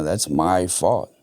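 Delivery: ordinary talk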